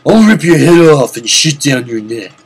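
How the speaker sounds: a hint of a gruff action hero; Duke Nukem. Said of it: "doing a Duke Nukem impression".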